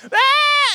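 a high, drawn-out mock bleat of a scared lamb; frightened lamb bleat. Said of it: "just louder".

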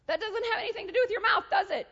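high, loud rhetorical scolding; mock scolding. God checking the speaker's mouth, loud mock reproof.

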